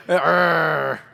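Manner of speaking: loud pirate growl. Shown as a demonstration. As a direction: growl a big pirate arr. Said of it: "let me see your pirate".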